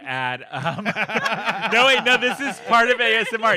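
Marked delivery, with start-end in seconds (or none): 0.8-3.5 loud laughing, talking fast. Real, not acted. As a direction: laugh through the words. Laughing mid-story interruption.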